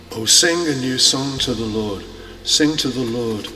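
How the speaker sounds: ordinary talk, nothing marked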